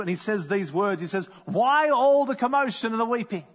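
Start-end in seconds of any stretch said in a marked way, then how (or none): 1.5-3.4 raised, commanding question; Jesus silencing the mourners; stern rebuke. Acted. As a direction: stop the wailing crowd firmly. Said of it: "puts a quick stop to it".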